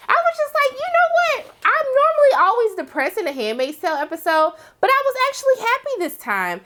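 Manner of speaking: high, bright squeal of joy; delighted gush. Real, not acted. Vlogger gushing to viewers about the happy montage, high excited squeal. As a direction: gush like a thrilled fan.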